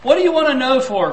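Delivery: an annoyed father growling at his son over his newspaper; growled. Retold or imitated. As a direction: snap the question back. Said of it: "growled Daddy".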